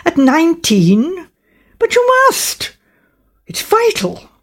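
put-on high-pitched, incredulous young man; urgent, astonished insistence. A narrator's reading. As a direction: protest in disbelief, pitch leaping way up. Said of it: "demanded the young man incredulously".

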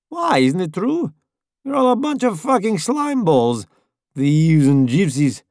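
high, jeering driver voice; taunting Italian voice. A narrator's reading. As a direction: bait him with a jeer.

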